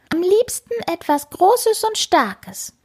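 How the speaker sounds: high, eager little voice; small kitten wanting to be big. Acted. Kitten answers father. (hohe, eifrige Kätzchenstimme)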